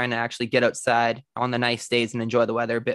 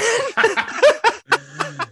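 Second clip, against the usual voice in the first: high burst of laughter; 5 dB louder than usual. Real, not acted. Laughing at an existential question.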